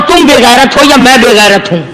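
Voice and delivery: ordinary talk, nothing marked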